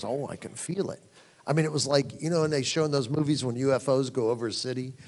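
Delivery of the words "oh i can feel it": soft, low retold whisper-like answer; hushed reply. Preacher voicing his wife's reply in bed, hushed and low. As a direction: answer quietly, keeping it low.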